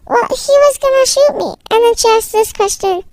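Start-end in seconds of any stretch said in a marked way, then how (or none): 0.1-1.5 higher-pitched self-justifying voice; defensive protest. Acted. Child defends herself to her mom after being told off, high defensive protest. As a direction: protest it high, making an excuse.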